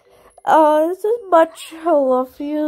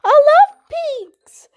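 high toy voice; 11 semitones higher, 4 dB louder, slower than usual. Pretend. Toy character gushing over pink.

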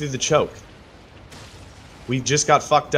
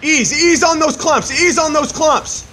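shouted callout; 11 semitones higher, 5 dB louder than usual. Real, not acted. Raid leader shouting orders.

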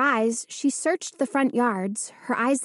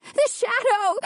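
frightened cry; 11 semitones higher than usual. A narrator's reading. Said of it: "she cried".

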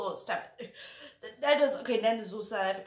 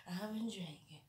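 hushed aside; quiet; 12 dB quieter than usual. Real, not acted. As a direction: drop it to a hush. Whispered repeat of confession.

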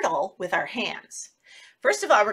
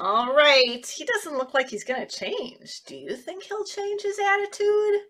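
normal talking voice

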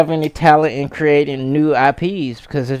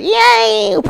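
mock cheer. Imitating excited gamer.

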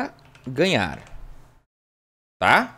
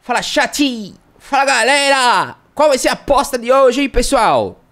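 much higher and louder, sing-song hype host; hammed-up hyped youtuber; 6 semitones higher, 4 dB louder than usual. Streamer mocking youtubers to his chat, loud sing-song hype voice. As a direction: belt it like an over-the-top YouTube intro, half-singing.

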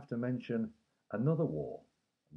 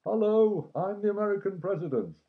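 Roosevelt voice; 9 semitones higher, 6 dB louder than usual. Pretend. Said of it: "President Roosevelt".

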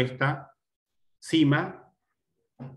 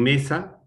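"mesa" with a strong, raised ME; stressed syllable; 4 semitones higher, 5 dB louder than usual. Shown as a demonstration. Teacher showing which syllable carries the accent, stressed syllable. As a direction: put more voice on the ME of "mesa". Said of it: "las que llevan mayor fuerza de voz".

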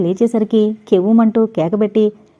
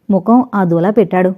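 plain reading voice

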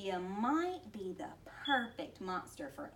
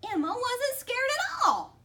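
ordinary delivery

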